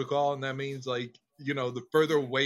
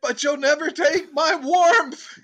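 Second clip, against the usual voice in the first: high, loud mock-heroic cry; hammed-up defiant oratory; defiant dogs' battle speech; 12 semitones higher, 7 dB louder than usual. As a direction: declaim it like a war speech, stretching the ending. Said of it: "That's what the dogs are thinking".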